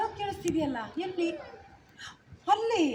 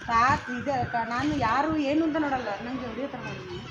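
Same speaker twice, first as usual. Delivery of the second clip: usual speaking voice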